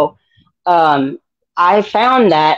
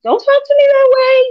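much higher, indignant protest; sassy talk-back; faster than usual. Retold or imitated. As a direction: snap back at him. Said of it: "I'm like".

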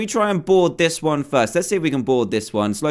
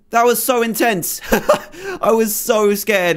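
high, breathless laughing; laughing relief; 6 semitones higher than usual. Real, not acted. Gamer after the fight, laughing with relief. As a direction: laugh out the tension.

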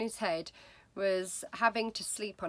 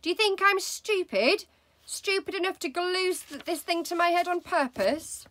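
much higher, loud angry yelling; angry shout; 10 semitones higher, 7 dB louder than usual. Acted. Husband raging at wife about the glue, loud and high. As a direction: keep raging at her.

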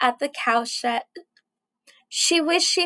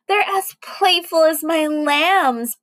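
ordinary delivery

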